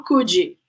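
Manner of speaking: "could you" as "coodjee"; low "coodjee" with an added vowel; wrong pronunciation. Shown as a demonstration. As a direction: end "could" in a "jee" sound. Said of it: "There's no".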